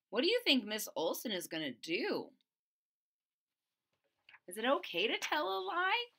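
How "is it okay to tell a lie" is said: plain reading voice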